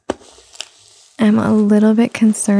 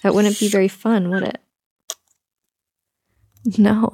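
usual speaking voice

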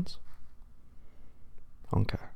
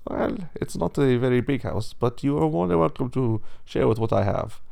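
higher-voiced, welcoming pig character; gracious cousin pig; 4 semitones higher, 4 dB louder than usual. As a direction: offer hospitality in a raised character voice.

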